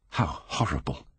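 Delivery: a soft, low-level murmur to himself. A narrator's reading. Dorian recoiling from the portrait, quiet murmur. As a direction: breathe it out under the breath, appalled. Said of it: "he murmured to himself".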